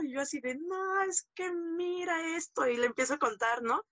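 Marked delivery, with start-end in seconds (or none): 0.6-2.7 high, drawn-out mock wail; lightly acted tearful complaint; retold tearful plea. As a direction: imitate crying out to grandma, slow and pitched up. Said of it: "todos así llorando".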